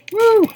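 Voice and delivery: high, loud woo; excited whoop. Real, not acted. Gamer whooping mid-fight, excited woo. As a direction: whoop in glee. Cheer while playing.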